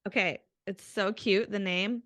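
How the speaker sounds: ordinary talk, nothing marked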